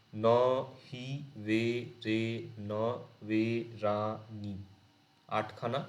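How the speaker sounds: very slow, one syllable at a time with pauses; counting syllables. Shown as a demonstration. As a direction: tap out each syllable separately. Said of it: "মজার বিষয় সিলেবেলগুলো সমান". (ধীরে ধীরে প্রতিটি syllable আলাদা করে গোনা)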